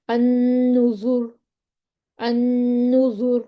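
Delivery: النذر drawn out very slowly with a heavy ر; heavy ra recitation. Shown as a demonstration. Teacher reciting النذر to students, slow with heavy ر. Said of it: "موٹا پڑھیں گے".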